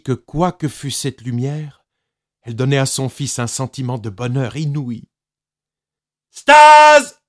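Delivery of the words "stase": high, loud loudspeaker bellow; booming loudspeaker alarm; speaker yells. A narrator's reading. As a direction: blast it over the speakers. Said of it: "hurla le haut-parleur".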